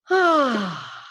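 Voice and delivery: long falling "ah" sigh; dramatic sigh. Shown as a demonstration. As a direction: let it all go with a sigh. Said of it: "So everyone".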